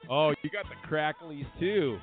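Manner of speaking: ordinary talk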